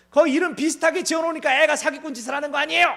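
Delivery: high, loud, fast blame; blaming father. Acted. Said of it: "아버지한테 막 탓을 합니다".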